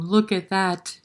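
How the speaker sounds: plain reading voice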